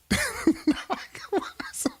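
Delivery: airy high giggle with no voice; a silent wheezing laugh; breathless whispered giggle. Real, not acted. Laughing at the video.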